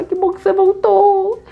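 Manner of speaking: high, loud, overjoyed cry; acted-out tearful-sounding welcome; emotional relief. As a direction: greet a friend you nearly lost. Friends hug girl who came back.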